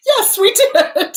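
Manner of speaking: very high, slow tearful squeal; mock tearful sobbing. Client answering her therapist in tears, exaggerated sobbing. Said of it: "in tears, sobbing".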